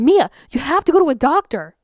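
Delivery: high, loud, tearful plea; sobbing plea. Retold or imitated. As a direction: beg her friend through sobs. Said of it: "she's outright sobbing".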